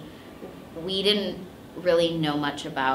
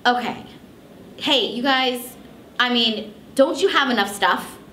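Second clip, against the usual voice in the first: frustrated; 6 semitones higher than usual. Retold or imitated. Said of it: "really getting frustrated".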